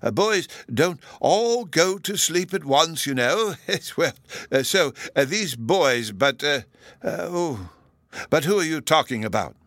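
high, halting character voice with chuckles; dithering other professor. A narrator's reading. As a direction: ramble and lose the thread between ums.

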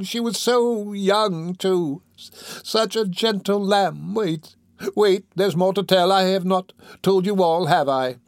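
put-on high-pitched, plaintive old woman lament; rambling dying woman. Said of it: "rambling on and not heeding".

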